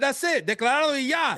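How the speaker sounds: high, playful Spanish quip; mock Austin Wells. Joking about Austin Wells speaking only Spanish, high mock voice. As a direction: lightly voice the player going full Dominican. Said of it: "He said".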